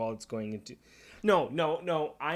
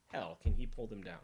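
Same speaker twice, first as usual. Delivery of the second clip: normal talking voice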